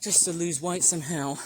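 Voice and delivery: usual speaking voice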